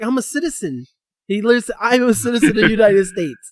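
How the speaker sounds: high, loud mocking declaration; mock proud citizen. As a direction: lampoon his solemn claim. Mocking friend's claim.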